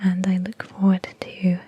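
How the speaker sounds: gentle unvoiced whisper throughout; ASMR whisper. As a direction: whisper close to the mic, easy and friendly.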